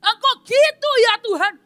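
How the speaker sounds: high, loud boastful complaint to God; self-righteous rant. Acted. Man rants at God about his tithes, high loud boasting. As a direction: list your good deeds in outrage. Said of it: "mulai berkata".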